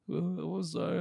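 the guy backing down, lightly voiced near crying; mock tearful apology. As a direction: play him small and close to tears. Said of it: "he looked like he was gonna cry".

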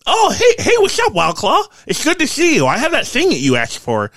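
very high, loud, eager greeting; excited Quentin. Acted. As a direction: squeal a friendly hello as an excitable craftsman. Game master plays Quentin.

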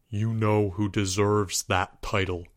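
low and soft, steady and grave. A narrator's reading. Student answering his teacher's challenge, low and soft. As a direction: say it low and serious. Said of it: "replied Albert in a low voice".

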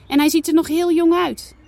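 put-on a child fretting over a lost kitten; young girl.

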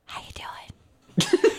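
slow, soft whisper; drawn-out hushed check-in. Acted. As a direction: whisper a casual greeting. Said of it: "She whispers".

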